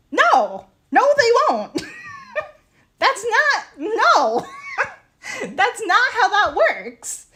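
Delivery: loud, high-pitched protest breaking into laughs; exasperated. Real, not acted. Seller rejecting the clerk's claim that shoppers will ask, exasperated. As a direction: object in laughing disbelief, voice way up.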